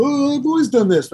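high, put-on whiny excuse; mock defensive bandmate.

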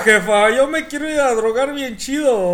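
put-on lampoon a Mexico City kid; loud, high mocking chilango accent. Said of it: "tienen ese acento".

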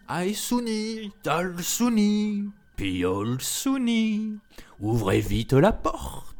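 high, slow sing-song call, tiger disguised as mother. A narrator's reading. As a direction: call the names slowly, sweetly. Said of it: "s'écria-t-il".

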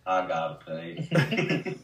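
very slow, drawn-out Forrest line; Forrest Gump voice. Retold or imitated. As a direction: stretch out "pay" like Forrest. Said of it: "I say it like that".

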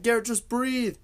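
high, retold urgent plea; urgent coaxing. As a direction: urge it in a high-pitched voice. Acting out urging giggler to calm.

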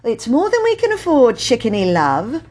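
bright, high cheerful mother; cheerfully. Acted. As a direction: brush it off brightly. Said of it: "said Mother cheerfully".

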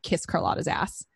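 normal talking voice

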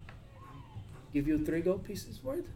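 name a price, lightly voiced; lowball offer.

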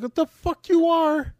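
high, loud exasperated outburst; retelling yelling at a careless friend; incredulous yell. Retold or imitated. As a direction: yell at him in disbelief.

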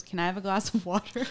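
lightly imitated calm movie child; a hint of an unbothered child; calm little girl. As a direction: mimic a kid's matter-of-fact request. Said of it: "She says it so calmly".

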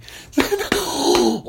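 loud, high squeal of laughter; high delighted laugh. Real, not acted. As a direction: shriek with glee. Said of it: "See how excited?".

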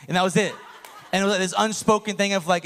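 ordinary talk

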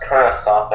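Coruscant as "KUR-uh-sahn", final t dropped; variant pronunciation. Shown as a demonstration. Co-host listing ways they've heard it, Coruscant as "kur-uh-sahn". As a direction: say Coruscant with "kur" first and "ahn" last. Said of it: "pronounced so many different ways".

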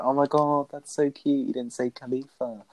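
lightly voiced sweet girlfriend; gushing girlfriend. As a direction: coo it like a delighted girlfriend. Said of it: "she's like".

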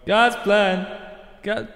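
sung with heavy echo; like singing in a cave. Acted. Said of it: "no club is this echoey".

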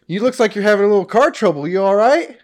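high, loud stranger hailing a motorist; friendly horse rider. Pretend. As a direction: play a helpful rider calling over. Plays a rider offering help.

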